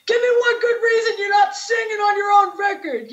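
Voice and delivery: high, loud mock outburst; confronting shout. Vinny confronting the singer in the parking lot, confronting shout. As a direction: yell it like you've just flung the door open.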